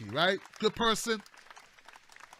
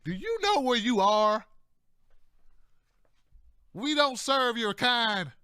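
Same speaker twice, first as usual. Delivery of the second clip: hostile biker; slower than usual. Retold or imitated. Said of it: "they're like".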